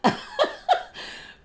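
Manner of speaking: high, rising giggle; real giggle she can't stop. Real, not acted. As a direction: giggle helplessly after the apology. Still laughing after apologising.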